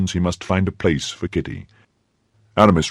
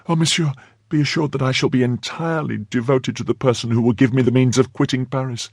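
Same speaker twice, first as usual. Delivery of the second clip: put-on high, fervent young woman; grateful maid Kitty; 6 semitones higher than usual. A narrator's reading. Maid thanking Aramis for escape, high and fervent. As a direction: pledge devotion in a high voice.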